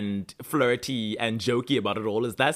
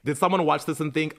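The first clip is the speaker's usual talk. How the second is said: ordinary talk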